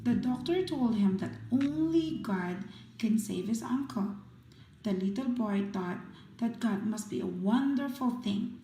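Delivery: ordinary delivery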